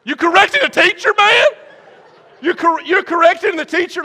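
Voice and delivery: loud, very high incredulous squawk; shocked disbelief. Retold or imitated. Student stunned at classmate correcting teacher, shocked disbelief.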